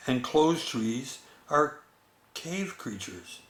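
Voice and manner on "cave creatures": ordinary delivery, nothing marked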